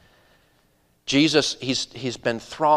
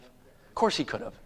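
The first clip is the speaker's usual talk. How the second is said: ordinary delivery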